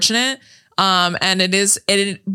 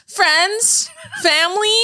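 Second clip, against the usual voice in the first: high-pitched mock address to friends; mimicked movie line; 13 semitones higher, much slower than usual. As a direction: quote the movie girl in a higher voice. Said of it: "the way she's like".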